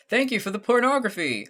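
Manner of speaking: high, loud, emphatic proclamation; booming, oblivious thanks; Gabriel. Acted. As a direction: announce it with earnest gusto. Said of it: "that's my Gabriel right there".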